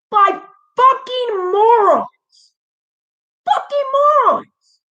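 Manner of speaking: angry outburst. Real, not acted. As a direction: let the fury spike on the repeat. Furious rant at company.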